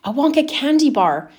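put-on a touch of a boy's eager cry; excited cry. A narrator's reading. Charlie guesses his birthday present, mildly excited cry. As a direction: brighten up on the candy bar. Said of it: "cried Charlie".

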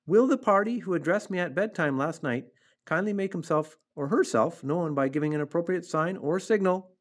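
high, formal public announcement; loud, firm voice. A narrator's reading. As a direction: project it like an official notice. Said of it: "in a loud, firm voice".

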